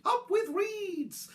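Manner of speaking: high, drawn-out duckbill hoot; high dinosaur cheer. A narrator's reading. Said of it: "they hooted".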